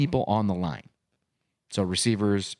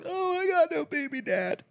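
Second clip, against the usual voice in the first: very high, small whimpering dog voice; scolded dog; 4 dB quieter than usual. Retold or imitated. Scolded dog answering its yelling owner, mock whimpering. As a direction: imitate a cowed pup pleading. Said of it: "And they're like".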